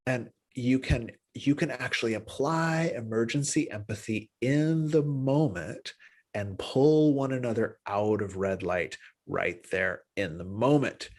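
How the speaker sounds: ordinary talk, nothing marked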